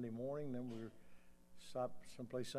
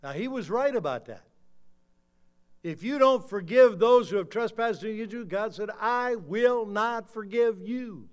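loud, high-pitched pulpit proclamation; forceful preaching; 10 semitones higher, 16 dB louder than usual. Acted. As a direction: drive the warning home, stretching the final words.

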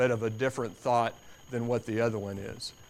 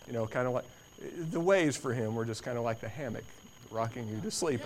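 ordinary talk, nothing marked

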